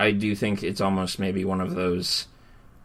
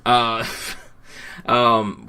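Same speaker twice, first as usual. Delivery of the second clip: normal talking voice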